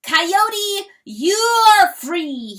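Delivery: a loud, high, ringing crow of a rooster; proud crow. Acted. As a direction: call it out like a rooster's triumphant crow. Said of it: "he crowed".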